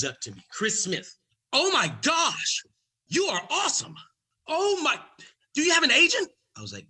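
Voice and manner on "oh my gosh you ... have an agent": high, loud gushing agent voice; excited agent. Retold or imitated. Agent gushing over the comic's set. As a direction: gush like a starstruck Hollywood agent.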